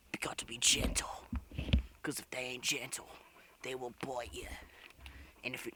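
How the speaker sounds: low, half-whispered warning; hushed warning. Pretend. Wildlife host warning viewers about biting animals, hushed. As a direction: drop to a wary hush.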